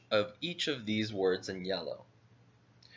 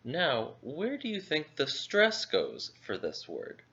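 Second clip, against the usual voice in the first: ordinary talk, nothing marked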